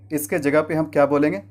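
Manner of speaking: ordinary talk, nothing marked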